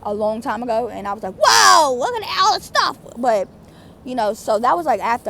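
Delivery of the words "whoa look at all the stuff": high, loud whoa of wonder; acted wide-eyed amazement; amazed. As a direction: gawp like someone back from long ago. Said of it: "it just felt like I came back".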